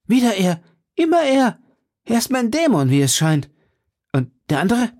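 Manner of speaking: high, agitated exclamations; crying out. A narrator's reading. D'Artagnan recognising his demon, crying out in agitation. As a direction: exclaim it high, worked up about an old enemy. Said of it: "rief D'Artagnan".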